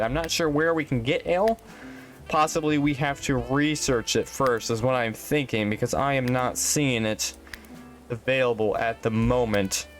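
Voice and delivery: normal talking voice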